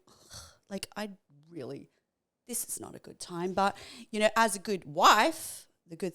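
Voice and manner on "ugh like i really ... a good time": breathy, half-voiced, unwilling; reluctant mutter. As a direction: mutter it like you'd rather not. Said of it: "I'm like".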